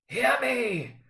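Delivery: high urgent ghost call; ghostly cry. Acted. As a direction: cry out to seize his attention. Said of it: "cried the ghost".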